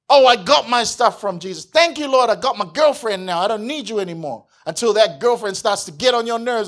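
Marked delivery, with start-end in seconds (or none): 0.1-4.5 loud, high, breezy and smug; ungrateful taker. Retold or imitated. Believer treating Jesus like Santa, mock smug. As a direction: rattle off thanks and dismiss him. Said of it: "treat him like he's Santa Claus".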